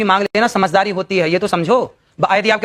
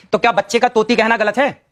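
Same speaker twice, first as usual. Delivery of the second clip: usual speaking voice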